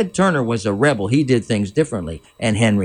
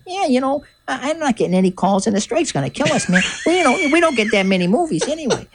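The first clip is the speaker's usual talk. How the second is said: high put-on voice, much higher than his own; little people voices; 14 semitones higher than usual. Little people actors griping about the strike, in a high put-on voice. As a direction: mimic out-of-work actors complaining in a pitched-up voice. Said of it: "talking in their little people voices".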